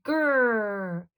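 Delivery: playful mock dino growl; dinosaur growl. A narrator's reading. Granny growling at grandson like a dinosaur. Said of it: "she growled like a dinosaur".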